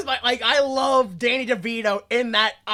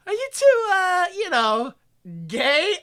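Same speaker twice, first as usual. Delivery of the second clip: awkward hesitant asking; 9 semitones higher, slower than usual. Retold or imitated. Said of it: "When he goes".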